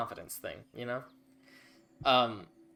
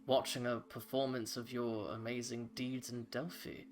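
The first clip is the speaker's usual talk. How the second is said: head priestess of Apollo formally greets the party; serene elf priestess; 10 dB quieter than usual. Acted. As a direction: speak with calm solemn grace. Priestess keeps speaking.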